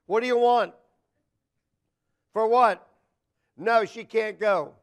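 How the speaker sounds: loud, curt phone questioning; mildly hammed-up father turning away a caller; gruff-father act. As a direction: fire back loudly at the caller. Father screening boys who call.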